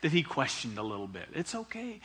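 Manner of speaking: gentle reassurance. Acted. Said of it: "with a smile".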